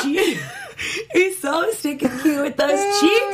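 doting, slow and gushing. Real, not acted. Gushing over baby.

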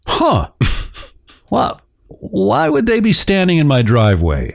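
mock-puzzled thought, pitched high. Voicing wife's puzzled thoughts.

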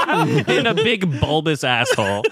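laughing. Real, not acted. Co-host topping a crude joke about Rafiki, laughing. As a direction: get the crude line out while cracking up.

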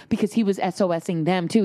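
normal talking voice

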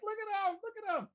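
high cooing grown-ups; doting adults. Retold or imitated. Adults cooing over a crawling baby, high and doting. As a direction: coo over the baby like proud parents. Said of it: "all of the adults were like".

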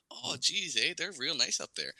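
exaggerated high Canadian accent. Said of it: "I guess that was a Canadian accent".

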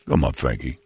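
mumbling Stallone voice; lightly voiced Sly on the phone; Sylvester Stallone impression. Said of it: "it's a Sly".

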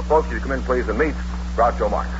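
ordinary talk

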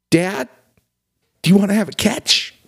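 high, hammed-up emotional plea; mock choked up. Son asking his dad for a catch, mock choked up. Acting film's tearful reunion line.

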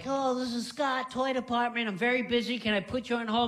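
high, rushed clerk voice; mock a harried store clerk answering; busy toy clerk. Friend answering the toy department phone, high mock clerk voice. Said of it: "he'd pick up the phone like".

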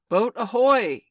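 full, high, very loud sea captain's shout; big carrying seaman's holler; ringing hail. A narrator's reading. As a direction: bellow it across the lagoon. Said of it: "came the voice again".

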